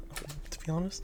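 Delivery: unvoiced shy whisper. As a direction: breathe it out timidly. Shy confession in dialogue.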